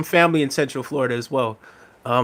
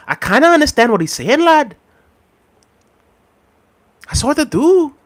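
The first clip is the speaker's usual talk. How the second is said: much higher put-on Liverpool voice; mock Scouse accent; 9 semitones higher, 3 dB louder than usual. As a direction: imitate a Liverpool pundit, ending on "lad". Mimics Scouse pundit Jamie Carragher.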